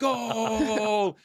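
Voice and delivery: high, drawn-out goal cry; screaming football commentator. Retold or imitated. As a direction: imitate an excited commentator's long goal call. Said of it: "it screamed".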